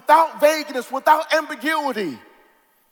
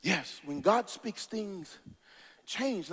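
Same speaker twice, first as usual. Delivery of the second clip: soft, lower and slow; quiet refrain; 4 semitones lower, 10 dB quieter, slower than usual. Real, not acted. Preacher repeating the refrain softly to the congregation. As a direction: say it hushed and low, letting it sink in.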